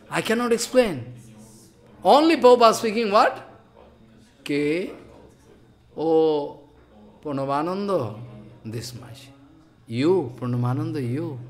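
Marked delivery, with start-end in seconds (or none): 4.4-11.4 softer, gently imitating a guru calling a disciple; mild hush of a loving elder. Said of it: "So loveful speech".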